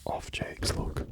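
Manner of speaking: ordinary delivery, nothing marked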